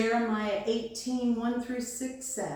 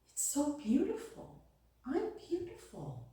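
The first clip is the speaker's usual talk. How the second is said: high, soft amazement; awed wonder; 6 semitones higher, 6 dB quieter than usual. A narrator's reading. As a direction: lift it high and hushed, marvelling at your reflection. Cup marvels at its reflection.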